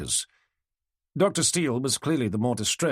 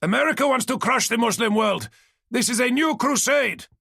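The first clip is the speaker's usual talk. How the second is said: angry youth; 13 semitones higher, 5 dB louder than usual. Acted. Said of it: "gestured angrily".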